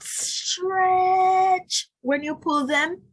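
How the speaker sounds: high and slow, words pulled like elastic; teacher voicing elastic stretch for children. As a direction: stretch the words out like a rubber band.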